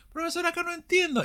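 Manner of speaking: high, quick pupil's complaint; confused student. Acted. Student telling teacher he doesn't understand, high and confused.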